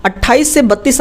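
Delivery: usual speaking voice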